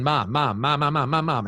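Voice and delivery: lightly voiced child calling for Mom over and over; rapid, insistent mock nagging; imitated pestering child. Kids trying to get a distracted parent's attention, mock pestering. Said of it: "are they saying".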